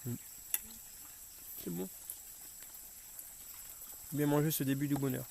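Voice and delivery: talking while chewing; mouth full. Real, not acted. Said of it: "On parle pas la bouche pleine".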